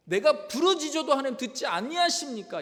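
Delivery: high, loud acted protest; confronting God. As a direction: challenge God head-on as the aggrieved prophet. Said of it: "이렇게 막 따집니다".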